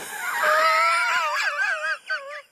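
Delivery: high cackling villain laugh; gloating wicked laughter; evil laugh. Acted. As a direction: throw back your head and cackle.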